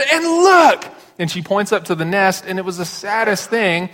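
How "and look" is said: put-on wailing little girl. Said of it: "And she said".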